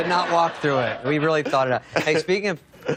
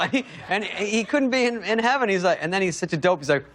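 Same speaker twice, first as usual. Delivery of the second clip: normal talking voice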